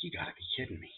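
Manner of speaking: whispered, stunned. Acted. Groom on his wedding night, whispering in disbelief. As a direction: breathe it out in disbelief. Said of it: "And he's like".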